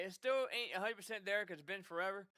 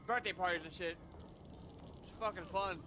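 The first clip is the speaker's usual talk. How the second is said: ordinary talk, nothing marked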